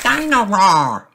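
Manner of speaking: put-on high, slow little toddler voice; a tiny piglet calling his toy; toddler George. A narrator's reading. Toddler wanting his toy in the bath, high slow call. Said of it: "says George".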